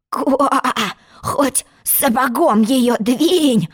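slow, drawn-out croaking; hoarse frog. Acted. Frog kicking the stuck door, slow hoarse croak. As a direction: croak it hoarsely, dragging the words. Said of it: "Он так хрипел".

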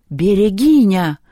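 slow, loud amazed exclamation; gasped astonishment. A narrator's reading. Перун recognises what Люба is, astonished gasp. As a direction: stretch the name out in shock. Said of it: "ахнул Перун".

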